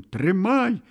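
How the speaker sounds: high-pitched holler; wailing cry. A narrator's reading. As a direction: cry out in the scramble. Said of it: "с воплем".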